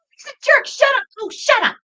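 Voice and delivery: high-pitched yell at the screen; retold yelling. Moviegoer re-enacting outbursts at the theater screen, high retold yelling. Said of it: "yelling at the screen".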